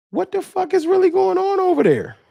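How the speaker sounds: jaw-dropped fan hearing bad news about the rival; retold disbelief. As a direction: blurt it out in shocked disbelief. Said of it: "I said".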